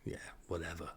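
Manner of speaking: dismissive aside, barely voiced; whisper. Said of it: "I just whisper".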